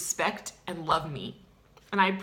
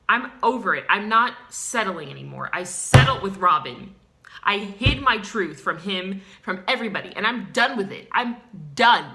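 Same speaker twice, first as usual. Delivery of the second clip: louder and louder furious vent; loud rant; 10 dB louder than usual. Acted. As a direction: shout it out to the camera. Ranting after breakup story.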